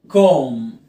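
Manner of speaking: drawn-out word closing on m; silent final b. Shown as a demonstration. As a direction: end on a long humming m. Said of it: "ما تنطقش ال بي".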